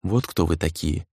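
soft, low half-whisper; narrated whisper. A narrator's reading. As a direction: say it low and hushed, as if to oneself. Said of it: "прошептал Сагюнара, пытаясь прогнать видение". (тихо и низко, полушёпотом)

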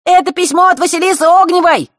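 high loud exclaim; выкрик. Acted. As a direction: shout it out gleefully. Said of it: "вскричал Феликс". (громкий торжествующий выкрик)

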